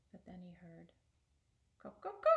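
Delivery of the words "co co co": rooster clucking. Acted. Rooster tapping the stove to the old man, loud high clucking.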